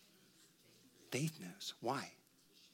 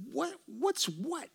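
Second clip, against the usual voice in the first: high, slow, puzzled mock voice; a hammed-up high bewildered question; baffled mock voice; 12 semitones higher, 4 dB quieter, much slower than usual. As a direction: play a baffled onlooker, drawn out and high. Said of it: "and you go".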